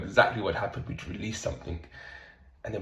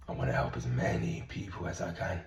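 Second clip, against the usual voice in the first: sincere low repeat; 8 semitones lower, 4 dB quieter than usual. Real, not acted. Coach signing off to followers, low soft sincere repeat. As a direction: lower and softer, meaning every word.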